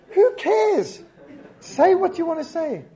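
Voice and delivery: loud, high, fed-up cry; exasperated outburst. Real, not acted. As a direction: blurt it out, utterly fed up. Fed up with rambling speakers.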